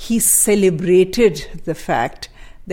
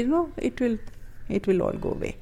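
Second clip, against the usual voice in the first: softly imitating a mild father; quiet reassurance; 4 semitones higher, 8 dB quieter, faster than usual. Daughter quoting her jailed father facing a death sentence, quietly. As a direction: say it gently, brushing the danger off. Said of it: "he would very quietly say".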